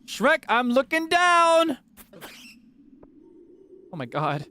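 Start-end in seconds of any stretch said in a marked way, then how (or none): 0.0-1.8 from nervous to a high, slow wail; building panic. Real, not acted. Gamer calling to Shrek while looking down in fear. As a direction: panic rising until you cry out. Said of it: "I'm getting nervous".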